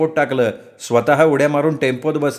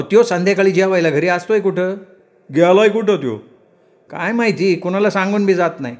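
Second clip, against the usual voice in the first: higher-pitched grumbling woman's voice; village woman complaining; 6 semitones higher than usual. Acted. Answering Aaba about the absent Datta, complaining woman.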